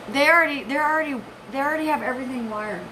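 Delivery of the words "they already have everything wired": usual speaking voice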